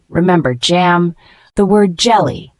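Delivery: ordinary talk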